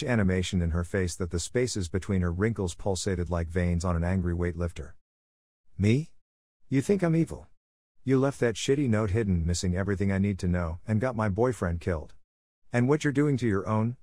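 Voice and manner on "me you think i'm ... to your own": ordinary delivery, nothing marked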